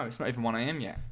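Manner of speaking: ordinary talk, nothing marked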